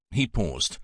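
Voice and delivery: normal talking voice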